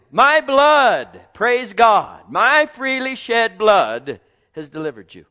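loud, high preacher's cry; preaching at full force. Acted. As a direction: proclaim it to the congregation.

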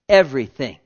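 loud, emphatic cry of the word; a booming everything. Real, not acted. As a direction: proclaim it with force. Emphatic repeated word in sermon.

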